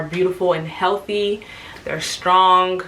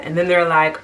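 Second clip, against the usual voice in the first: ordinary talk, nothing marked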